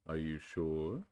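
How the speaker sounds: very slow doubtful donkey; slow gloomy Eeyore voice. Acted. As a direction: doubt it in a glum drawl.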